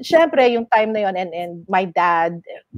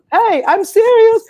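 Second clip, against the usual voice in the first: much higher, louder and slower call; 13 semitones higher, 6 dB louder, slower than usual. Retold or imitated. Calling after Mister M as he walks out, a loud insistent call.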